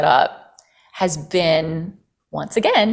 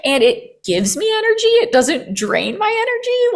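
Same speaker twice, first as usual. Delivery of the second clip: high, bright realization rising higher; delighted surprise; 7 semitones higher, 3 dB louder than usual. Retold or imitated. Telling herself the new work pays more, delighted. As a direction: discover the good news with a laugh in it. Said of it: "I'm like".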